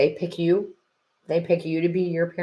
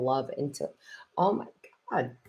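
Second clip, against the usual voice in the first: real tears welling, words breaking off; halting apology while choking up; tearing up; 3 semitones lower, 5 dB quieter than usual. Real, not acted. Reader overcome by talk of a mother's love, tearing up. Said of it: "literally tearing up".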